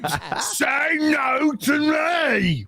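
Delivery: slow, high shouted Cockney-Russian that slides into South African; Ray Winstone's Russian accent. Retold or imitated. Friend mocks Ray Winstone's accent, slow mock shout. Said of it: "kinda comes out of South African".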